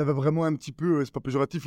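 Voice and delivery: ordinary delivery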